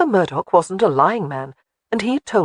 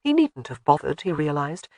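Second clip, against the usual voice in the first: plain reading voice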